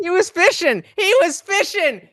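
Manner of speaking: high, loud, gleeful accusation; excited call-out. Real, not acted. Streamer calls out the guest fishing for compliments, loud gleeful crowing. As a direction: point at him and crow it.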